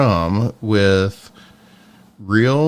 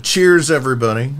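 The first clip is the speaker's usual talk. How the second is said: loud, high, cheery toast; excited toast; 4 semitones higher, 4 dB louder, faster than usual. Real, not acted. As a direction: call it out to the whole room. Calls out a toast to viewers.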